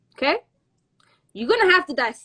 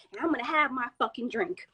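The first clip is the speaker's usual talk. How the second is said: normal talking voice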